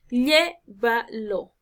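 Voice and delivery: "Llévalo" very slow, LLÉ-va-lo, first syllable stressed. Shown as a demonstration. Teacher giving an esdrújula example, syllable by syllable. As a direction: break "Llévalo" into three, leaning on llé. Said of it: "antepenúltima".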